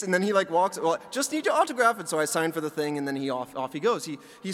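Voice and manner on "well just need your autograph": high mock German accent; German Willy Wonka. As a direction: imitate the courteous German waiter. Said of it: "like, a German Willy Wonka".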